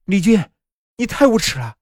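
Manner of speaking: high-pitched indignant accusation; outraged, high and unshouted; indignant outburst. A narrator's reading. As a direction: burst in and accuse him, voice pitched right up. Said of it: "我猛地推开门说道".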